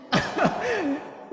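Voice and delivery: high mock sobs; put-on boo-hoo of a child; imitated child's crying. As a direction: imitate a small boy bawling. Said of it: "When my son looked at me crying".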